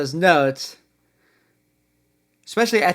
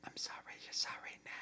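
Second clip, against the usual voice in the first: whispering along; 25 dB quieter, faster than usual. Real, not acted.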